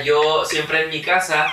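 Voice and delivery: ordinary talk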